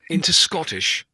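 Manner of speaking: usual speaking voice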